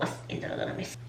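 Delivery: whisper. Real, not acted. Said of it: "whispered aside".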